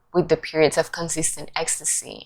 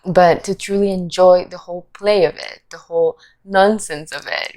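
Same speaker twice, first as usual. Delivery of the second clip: normal talking voice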